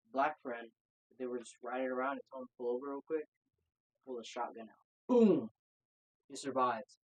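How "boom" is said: loud, high booming "boom"; imitated gunshot boom. Telling friends about the shotgun, loud imitated boom. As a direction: punch out a gunshot sound.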